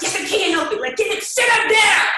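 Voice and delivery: loud mock outrage at husband; emphatic retort. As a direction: fire back at him. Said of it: "you know me, that's me".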